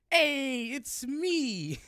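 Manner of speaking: high, drawn-out goblin greeting; goblin's cheery entrance. Acted. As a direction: burst in loud and high as a goblin. Goblin guard makes his entrance.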